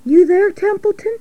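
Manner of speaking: high piglet voice calling a friend; high, hopeful call. Acted. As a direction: call out with a rising question. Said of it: "he called".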